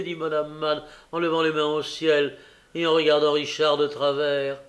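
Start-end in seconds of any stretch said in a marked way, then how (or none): none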